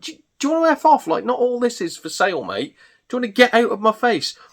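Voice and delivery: high-pitched exasperated rant; retold indignation. Stallholder to people nosing in his car, indignant. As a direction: act out being fed up with intruders. Acted irritation at nosy browsers.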